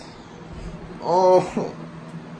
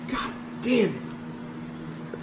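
drawn-out whisper; 5 semitones higher, 13 dB quieter, much slower than usual. Real, not acted. Exhausted after rage.

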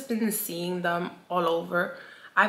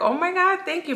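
high gushing fake gratitude; mock delighted thanks; 12 semitones higher than usual. To a gift giver, fake delighted. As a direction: gush a put-on thank you. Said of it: "I'm like".

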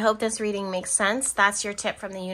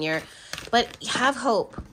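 ordinary talk

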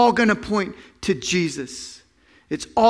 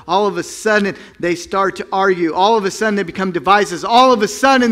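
usual speaking voice

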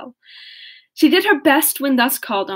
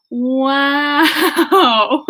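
a wow that breaks into laughter; real laugh at the chaos of the scene; 3 semitones higher, 3 dB louder than usual. Real, not acted. Reader laughing at the screaming child, amused wow.

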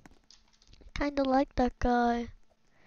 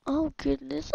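normal talking voice

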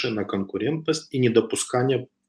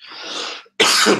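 real dry coughs, breathy and unvoiced; a sick man clearing his throat mid-lecture; coughing fit; 10 semitones higher, 3 dB louder than usual. Real, not acted. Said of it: "Прошу прощения". (сухой кашель)

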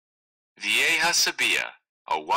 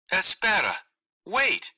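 calling after him; 10 semitones higher than usual. Acted. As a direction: shout for him to wait up. Frog calls after fleeing prince.

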